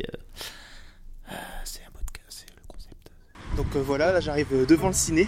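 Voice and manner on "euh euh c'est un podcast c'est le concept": hushed confiding whisper; whispered aside. Acted. Host whispering the show's concept to lost newcomers, whispered. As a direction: whisper it close to the mic, like a secret.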